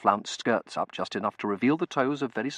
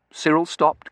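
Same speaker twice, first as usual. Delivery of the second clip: usual speaking voice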